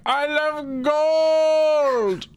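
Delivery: high, very slow, drawn-out cry; hammy villain gloating over gold; mock German villain. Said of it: "so I could just be like".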